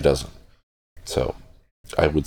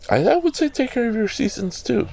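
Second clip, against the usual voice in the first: playful high pun. Pretend. Punning on seasons to viewers, silly high voice. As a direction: jump way up into a squeaky high voice for the joke.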